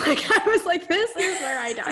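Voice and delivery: high, loud and laughing; amused, hammed-up dread. Real, not acted. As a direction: laugh through the mock doom. Laughing over her own retold dread.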